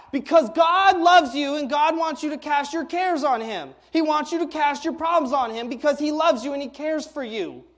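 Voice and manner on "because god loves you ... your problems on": preaching shout. Real, not acted. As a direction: proclaim it to the whole room. Preacher driving home his point.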